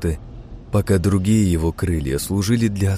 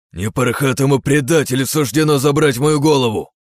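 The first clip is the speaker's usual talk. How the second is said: angry shout; 5 semitones higher, 4 dB louder than usual. Acted. Archangel's defiant battle cry.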